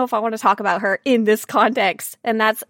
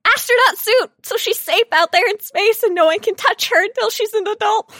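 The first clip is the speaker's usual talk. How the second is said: fast, hammed-up soothing that climbs higher and louder; mock reassuring; 6 semitones higher, 4 dB louder than usual. Player hamming up a tender promise to Nadia, mock soothing. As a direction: sweetly promise safety, building into a yelp. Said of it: "I'm like".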